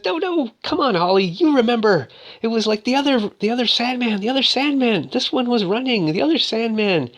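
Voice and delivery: high-pitched imitated woman's voice; insistent, pleading falsetto; urging woman. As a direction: plead high, jogging a friend's memory. Voicing Jessica urging Holly to remember.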